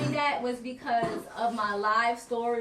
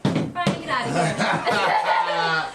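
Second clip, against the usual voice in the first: louder, drawn-out laughing brush-off; laughing tease; 6 semitones lower, 6 dB louder, much slower than usual. Retold or imitated. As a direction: laugh him off, slow then quick. Teasing Brian, laughing.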